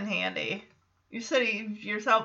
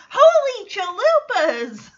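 high, louder mock exclamation; Max exclaiming; 8 semitones higher, 4 dB louder than usual. Host imitating Max's excited holy chalupas. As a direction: blurt it out like an amazed kid. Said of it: "Max says".

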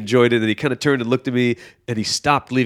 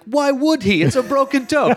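much higher, exasperated inner voice; incredulous; 14 semitones higher than usual. Retold or imitated. Comic mocking his own intro, high incredulous aside. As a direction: play the disbelief up high. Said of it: "I'm like".

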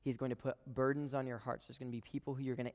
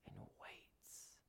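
whisper; 21 dB quieter than usual. Acted. Preacher whispers for effect.

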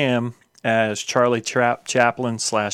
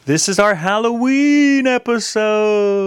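announcer voice; 11 semitones higher, 6 dB louder, slower than usual. Acted. Show intro announcement.

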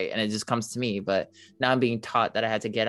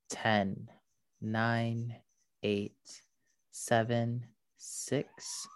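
airy breathy mystical healer; 6 dB quieter than usual. Acted. Leads a meditation.

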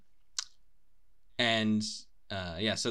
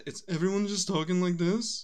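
put-on higher, louder botched Birmingham accent aping [name]; terrible [name] Birmingham accent; 9 semitones higher, 4 dB louder than usual. Podcaster showing how Birmingham talks, a terrible [name] Birmingham accent. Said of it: "That was a horrible [name]".

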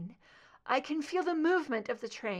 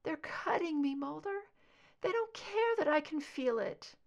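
higher, hurting voice; pained, desperate; 8 semitones higher than usual. A narrator's reading. Scully telling Mulder they cut her, in pain. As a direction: tell him while it hurts.

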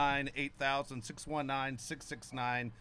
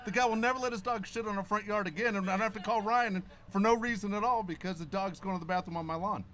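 loud, higher, pumped-up retelling; excited retelling; 7 semitones higher, 5 dB louder than usual. Retold or imitated. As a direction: tell it all fired up. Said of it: "my wife's like".

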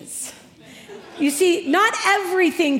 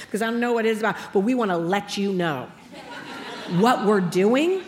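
usual speaking voice

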